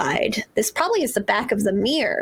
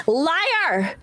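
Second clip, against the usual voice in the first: sharp, high cry of the voice; accusing spirit voice; 7 semitones higher than usual. Acted. The voice around them accuses, high and sharp. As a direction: hurl it from all around.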